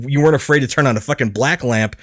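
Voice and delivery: usual speaking voice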